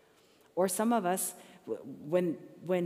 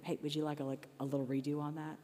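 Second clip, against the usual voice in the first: gentle offer; 3 semitones lower, 5 dB quieter than usual. Retold or imitated. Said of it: "I'll say to him".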